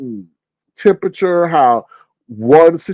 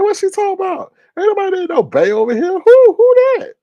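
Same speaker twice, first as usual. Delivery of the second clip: much higher, baffled mock voice; much higher voice, baffled by the nickname; mock confusion; 3 dB louder than usual. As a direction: squeal it in puzzled disbelief. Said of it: "I'm like".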